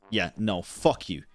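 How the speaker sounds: ordinary talk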